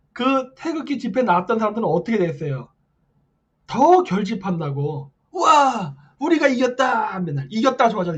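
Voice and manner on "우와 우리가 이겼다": higher, louder imitated celebration; mock victory cheer. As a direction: cheer like a triumphant crowd, mockingly. Mimics supporters celebrating victory. (승리에 환호하는 군중을 흉내 내는 외침)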